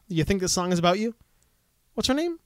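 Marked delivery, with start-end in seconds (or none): 1.9-2.4 "What's her name?" said much higher, rising at the end; straining to recall, voice jumping up; high asking rise. Real, not acted. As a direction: toss the question up high and searching. Asks again, searching memory.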